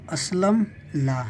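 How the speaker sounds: slow, careful read-aloud; slow reading demo. Shown as a demonstration. Teacher reading the picture sentence to a child, slowly. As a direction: spell out each word slowly for the pupil. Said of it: "teacher reads sentence aloud".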